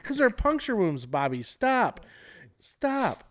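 high, alarmed mock parent; comedian's parent voice. Retelling a comic's panicked parent, high and alarmed. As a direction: yelp it high like a panicked dad. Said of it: "He's like".

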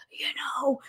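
mocking whisper. Shown as a demonstration. Telling listeners to stop whispering, in a mock whisper. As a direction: whisper it to show the habit. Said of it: "don't even whisper to me".

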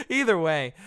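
laughing it off. Real, not acted. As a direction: say it through a laugh. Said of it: "I'm getting into personal stuff".

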